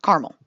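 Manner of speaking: "caramel" in two syllables, "CAR-mel". Shown as a demonstration. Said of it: "Yep, two syllables".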